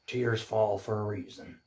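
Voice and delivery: put-on soft, low Irish-accented horse; quiet Irish accent, lightly put on. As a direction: speak gently in an Irish accent, trailing to a near whisper. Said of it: "do it better in his Irish accent".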